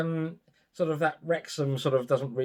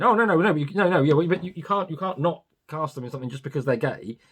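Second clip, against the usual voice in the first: higher, louder, flustered protest; mock flustered activist; 5 semitones higher, 4 dB louder than usual. Activist caught out, protesting in a higher, louder voice. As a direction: parody a flapping activist. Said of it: "the same activists saying".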